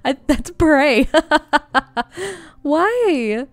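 high, loud bursts of disbelieving laughter; startled. Real, not acted. Gamer startled by a sudden attacker, laughing in disbelief. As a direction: laugh out in shock at the surprise.

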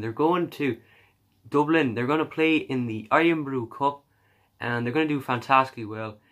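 hammed-up Irish accent. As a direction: keep the jokey Irish accent going. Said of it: "I'm gonna stop doing the accent now".

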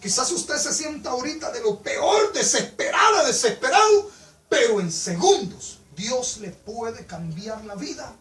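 rising to a high, loud preacher's cry, then slow; preaching at full force. Acted. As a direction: preach it out, climbing to a shout. Preaching builds to a peak.

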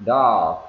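"dog" loud on a stretched o, g barely there; long vowel, tiny G. Shown as a demonstration. Teacher showing the small ending, "dog" with long vowel. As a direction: draw out "dog" and let the g fade. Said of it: "nghe rất là nhỏ".

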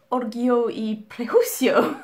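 slow, hammed-up Spanish accent; bad Spanish accent. Shown as a demonstration. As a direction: say the title in a clumsy Spanish accent. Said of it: "That was a very bad Spanish accent".

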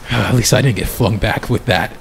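low, winded voice after a heavy sigh; sigh, then speak low and spent; breathing heavily. Acted. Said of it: "like, breathing heavily".

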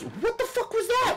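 very high startled outburst; shocked shriek. Real, not acted. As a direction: squeal in sudden shock. Sudden slip in game.

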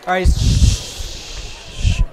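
loud, drawn-out shushing. Real, not acted. Speaker ending the audience exercise, loud shushing. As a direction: hush the room with long shushes. Hushing the crowd.

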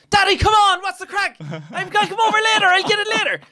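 put-on high shouted Traveller accent; mock Traveller accent. Girl shouting back to her daddy, high Traveller accent. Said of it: "you all thought that was a Derry accent".

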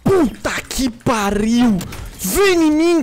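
yelling hyped at a kill; loud gamer hype; excited shout. Real, not acted. Sniper kill in game stream.

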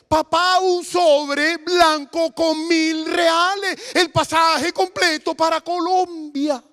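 high, drawn-out, tearful exclamation; acted daughter crying with joy; imitated overwhelmed cry. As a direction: wail the good news out slowly to papá. Daughter breathlessly reveals the miracle.

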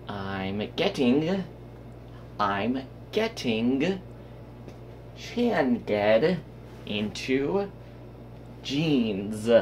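I'm getting slow, with jeans as zheenz; drawn-out demo, rising tunes; slow careful demo. Shown as a demonstration. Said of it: "Not with a Z".

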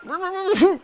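high squeaky cartoon laugh; a silly high giggle; mock laugh. Kidnapped girl in sack.

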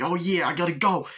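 high, rushed mock escape line; hammed-up hurry; hasty exit. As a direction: blurt it on the way out the window.

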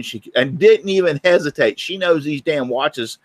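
usual speaking voice

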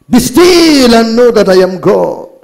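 high, loud scripture cry; loud proclamation. Real, not acted. Preacher reads scripture to the congregation, loud proclamation. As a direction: ring the verse out high and loud.

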